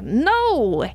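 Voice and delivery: sharp, high, loud burst; exclaimed no. Acted. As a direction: slam it down. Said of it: "he exclaims".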